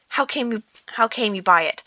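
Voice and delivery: plain reading voice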